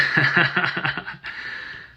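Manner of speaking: long string of laughs; hearty laugh. Real, not acted. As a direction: burst out laughing after the joke. Laughs after thanking a helper.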